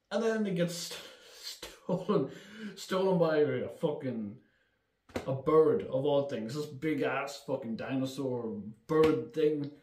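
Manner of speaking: ordinary talk, nothing marked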